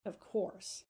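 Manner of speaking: usual speaking voice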